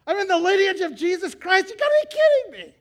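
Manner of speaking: very high, very loud astonished shriek; squealing you gotta be kidding me, joyful disbelief; ecstatic disbelief. Retold or imitated. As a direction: shout it as if heaven just shocked you. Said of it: "don't you think she had to be".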